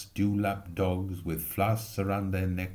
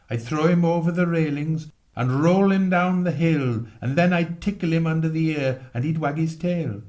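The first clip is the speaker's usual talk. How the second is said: put-on higher and louder, a boy boasting wildly; excited boasting boy; 6 semitones higher, 5 dB louder than usual. Boy boasting to his friends, excited and loud. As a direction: brag it like a thrilled child.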